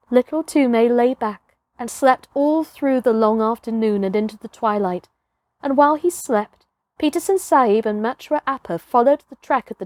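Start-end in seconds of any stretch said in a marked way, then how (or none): none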